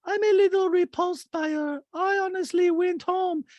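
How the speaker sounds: mocking Diana; high squeaky disgust. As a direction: whine like a disgusted diva. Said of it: "Diana's like".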